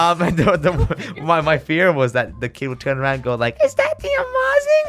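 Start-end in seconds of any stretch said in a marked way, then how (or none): 3.6-4.8 put-on very high, excited child voice; starstruck kid voice. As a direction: gasp like an awestruck fan kid. Kid recognizing a youtuber.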